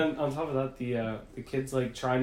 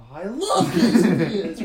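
higher, louder, slow gushing through a laugh; mock delight; 5 semitones higher, 11 dB louder, slower than usual. Comedian faking enthusiasm to a chatty kid, laughing gush. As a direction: overplay a grown man's fake enthusiasm. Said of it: "the guy's just like".

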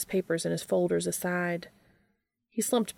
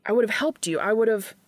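higher and louder, pleading; upset insistence; 4 semitones higher, 5 dB louder than usual. A narrator's reading. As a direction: insist through the hurt. Distressed brother insisting.

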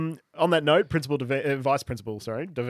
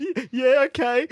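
high shaky stammered okay; tearful boy; 10 semitones higher, 7 dB louder than usual. Acted. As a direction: try to calm down but still blubbering. Crying boy trying to calm.

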